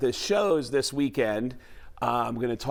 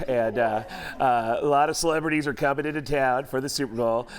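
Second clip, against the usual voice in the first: Tom Arnold impression; 3 semitones higher, 4 dB louder than usual. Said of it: "full-blown Tom Arnold on the air going".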